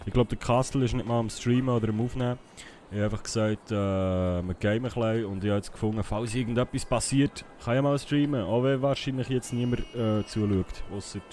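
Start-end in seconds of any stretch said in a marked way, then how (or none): none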